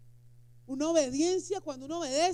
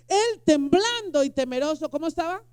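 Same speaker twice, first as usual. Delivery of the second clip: ordinary talk, nothing marked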